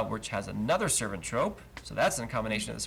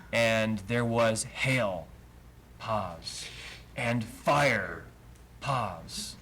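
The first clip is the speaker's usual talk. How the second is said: slow, with a full pause after "hail" and after "fire"; reading with pauses; much slower than usual. Shown as a demonstration. Teacher shows where the verse pauses. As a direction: read it slowly, stopping at each major lord. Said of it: "that's how it's meant to be understood".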